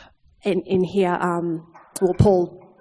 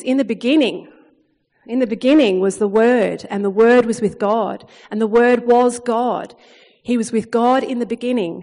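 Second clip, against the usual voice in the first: preaching scripture; 4 dB louder than usual. Acted. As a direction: proclaim each line with rising force. Reads John 1 with force.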